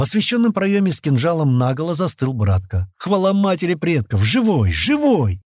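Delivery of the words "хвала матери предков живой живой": high, joyful exclamation; elated, raised-pitch outburst; overjoyed. A narrator's reading. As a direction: cry out in joyful relief. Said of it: "Не помня себя от радости".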